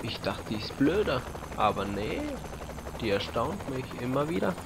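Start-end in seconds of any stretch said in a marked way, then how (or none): none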